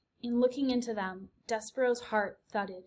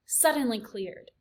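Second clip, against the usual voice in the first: plain reading voice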